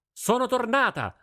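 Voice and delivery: high, loud shout across the room; girl shouting home to her father; loud call. Acted. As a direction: call out over the din of the forge. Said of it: "gridò a gran voce".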